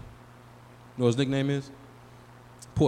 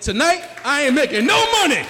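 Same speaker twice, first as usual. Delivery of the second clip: high, belted punchline; raised, emphatic proclamation; loud declaration; 10 semitones higher, 6 dB louder than usual. Real, not acted. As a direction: announce it loudly, building to a near shout. Loud punchline after plugging albums.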